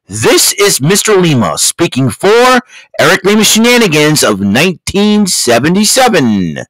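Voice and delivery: booming, high announcer sign-off; loud hyped-up announcer outro. Acted. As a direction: boom it out like a TV show announcer. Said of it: "Mister Announcer, please take us home".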